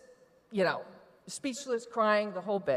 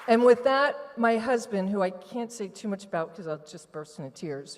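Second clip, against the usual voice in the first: ordinary talk, nothing marked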